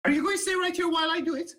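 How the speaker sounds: put-on high, eager young halfling guard boasting. Young halfling guard to the player, eager and high. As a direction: pitch it up like a keen young guard inviting a friend.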